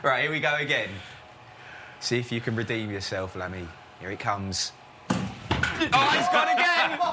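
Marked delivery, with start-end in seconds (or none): 5.1-7.0 gleeful outburst; excited exclamation. Real, not acted. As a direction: call it out, he's out again. Bowled again by machine.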